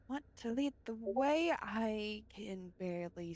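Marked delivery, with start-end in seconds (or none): none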